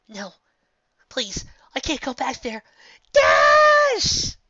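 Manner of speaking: very high, loud panicked scream for Dash; desperate cry. Acted. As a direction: scream for help as you're taken. Filly dragged away.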